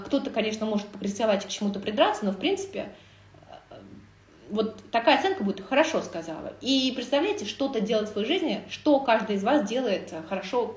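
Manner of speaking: ordinary delivery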